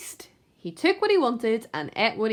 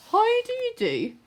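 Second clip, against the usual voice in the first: much higher chirpy duck voice; polite duck. Acted. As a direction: chirp it sweetly. Duck greets the rat.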